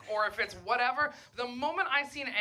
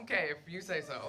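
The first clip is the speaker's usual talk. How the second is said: dismissive mumble; 5 semitones lower, 7 dB quieter than usual. Retold or imitated. Dismissive reply to angry friend.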